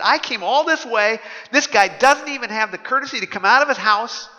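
high, fast, indignant rant; a proud general fuming at the insult; Naaman ranting. Acted. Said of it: "went off in a rage".

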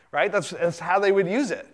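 normal talking voice

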